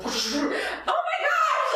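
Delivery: high laughing mock scream; mock panicked scream. Mother imitating her panic on the viral video, laughing scream. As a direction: squeal the panic, laughing through it.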